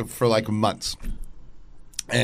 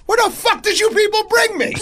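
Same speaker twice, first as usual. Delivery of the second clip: high, loud furious demand; angry yell; 3 dB louder than usual. Retold or imitated. The friend yelling angrily at his buddies. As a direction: yell at your friends in outrage. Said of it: "he looks at us angrily".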